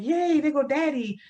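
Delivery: high, bright cheer as daddy comes home; a family's loud, happy welcome home cheer; excited cheer. Retold or imitated. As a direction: cheer him through the door. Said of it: "we're like".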